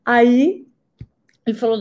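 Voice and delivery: normal talking voice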